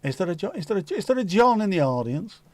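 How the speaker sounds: high, showy psychic compere; Clinton Baptiste impression. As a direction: camp stage medium calling out. Quoting Clinton Baptiste's act.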